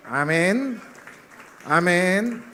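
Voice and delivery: loud, high rising amen; rising amen call. Real, not acted. Pastor calling the congregation to answer amen.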